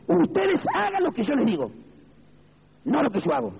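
high-pitched mock priest, laying down the rule; imitated priest. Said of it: "El cura de la Iglesia Católica". (imitando al cura, con voz muy aguda)

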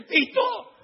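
very high, loud hopeful cry of the name; eager reply. Retold or imitated. Dante greets Tito expecting a gift, high eager cry. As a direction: answer like the money's coming.

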